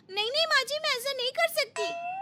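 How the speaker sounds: daughter-in-law fakes alarm at a misheard order; mock shock. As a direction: pretend to be horrified.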